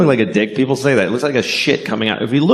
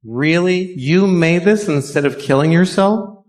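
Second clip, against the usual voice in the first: mock sneer; 8 semitones higher than usual. Mock shopper sneering at crafts.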